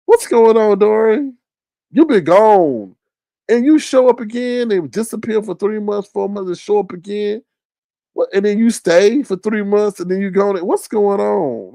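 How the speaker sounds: high-pitched, incredulous questioning; bewildered. Acted. Asking Dory about vanishing for months, bewildered. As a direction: press for answers in comic bafflement. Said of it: "we are just bewildered".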